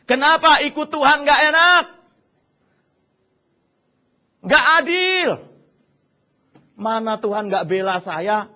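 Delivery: building from grumble to loud, high outcry; churchgoer's angry rant at God; quitting churchgoer's rant. Retold or imitated. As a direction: rant like someone leaving the church. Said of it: "banyak orang Kristen meninggalkan gereja".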